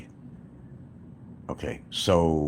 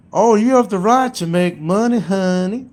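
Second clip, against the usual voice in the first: mock Southern lawyer; 8 dB louder than usual. Mocking his lawyer in a high Southern accent. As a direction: parody a sugary Southern lawyer. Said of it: "She puts on a Southern accent".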